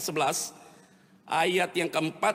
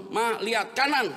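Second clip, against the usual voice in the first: higher-pitched mock order; bossy husband; 4 semitones higher, 4 dB louder than usual. Choleric husband orders his wife to look right, mock commanding. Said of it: "kalau suami udah ngomong".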